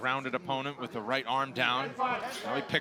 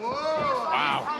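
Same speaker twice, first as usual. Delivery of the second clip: surprised wow; 11 semitones higher, 3 dB louder than usual. Real, not acted. Reaction to a big strike.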